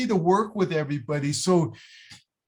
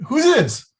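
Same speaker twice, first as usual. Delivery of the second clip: surprised; 8 semitones higher, 5 dB louder than usual. Retold or imitated. Surprise call from Alan.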